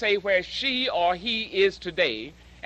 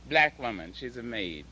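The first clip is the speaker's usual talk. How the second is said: ordinary talk, nothing marked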